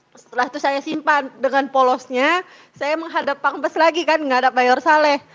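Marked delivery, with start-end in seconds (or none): none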